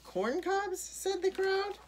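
very high rising question; high-pitched disbelief; astonished echo. A narrator's reading. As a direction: echo it high, like a baffled crowd. Crowd echoes in puzzlement.

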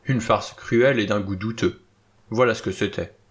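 ordinary delivery

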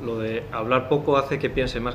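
ordinary talk, nothing marked

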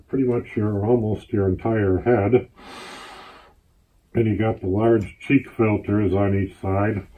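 muffled behind full mask; speaking inside rubber mask; through a gas mask. Real, not acted. Seen 0: Helmet-style gas mask worn over whole head throughout; references unmasked.

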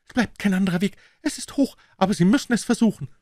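high urgent woman's voice. Acted. Woman urges jump.

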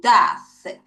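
death drawn out slowly; slow repeat. Shown as a demonstration. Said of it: "다시 death". (다시 death를 천천히 길게)

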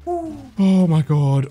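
high, drawn-out exclamation, sinking; stunned relief. Real, not acted. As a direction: let it out slowly in shock. Just escaped, in shock.

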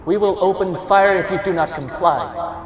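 loudspeaker warning. A narrator's reading. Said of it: "the officer declares".

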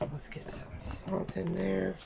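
voiceless whisper from lost voice; barely any voice, just breath. Real, not acted. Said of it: "I lost my voice".

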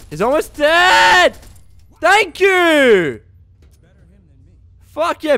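loud, slow, high cheer; excited shout. Real, not acted. Gamer nearly killing the enemy, excited shout. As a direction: cheer the kill.

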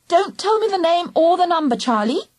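put-on mildly imperious child; ordering. A narrator's reading. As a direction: order a friend about. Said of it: "she ordered".

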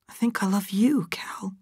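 high, whispery declaration of love; tender confession. A narrator's reading. Abra confesses love to Cal.

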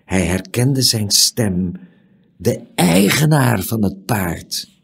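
ordinary delivery

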